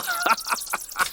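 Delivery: high, breathy cackle of glee; gleeful cackle. Acted. Companion cackles over the forbidden grave, high gleeful cackle. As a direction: cackle at the thought of the prize.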